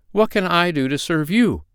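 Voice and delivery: happy cry. A narrator's reading. Grateful little man turns to the girl, happy cry. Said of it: "cried he".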